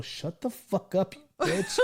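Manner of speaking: mock scolding. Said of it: "we look back at him and go".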